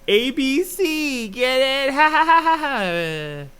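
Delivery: high, slow, hammed-up cackling voice; squeaky mock cackle; mock villain cackle. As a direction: ham up a cartoon evil laugh after the pun. Mock cackle after pun.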